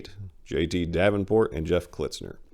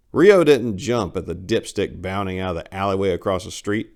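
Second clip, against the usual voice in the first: usual speaking voice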